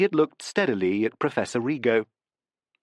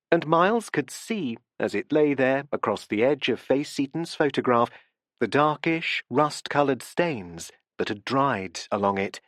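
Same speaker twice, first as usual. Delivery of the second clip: plain reading voice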